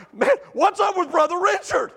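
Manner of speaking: high, wondering exclamation; amazed. Retold or imitated. Pastor retelling his amazement at Richard, high and wondering. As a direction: marvel at what he just did. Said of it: "I'm like".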